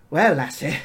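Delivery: loud Scottish lassie; Scottish farmer. Acted. Farmer warming up to the girl, Scottish accent. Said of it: "that Scottish accent always wins".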